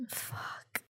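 ordinary talk, nothing marked

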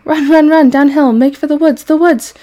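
high, loud goose hollering; hollered shout. A narrator's reading. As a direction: shout urgent orders at a runaway. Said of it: "she shouted to Wilbur".

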